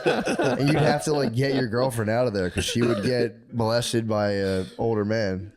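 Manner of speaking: ordinary delivery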